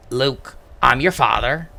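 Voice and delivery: a West Country accent on "Luke, I'm your father". Shown as a demonstration. Vader's line redone in a West Country accent. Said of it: "that's how a West Country accent sounds".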